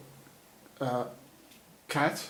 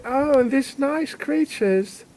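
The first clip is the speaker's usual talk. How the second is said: cooing; 11 semitones higher, slower than usual. Acted. Cooing over the cats.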